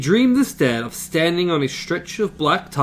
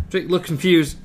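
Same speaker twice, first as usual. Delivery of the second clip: normal talking voice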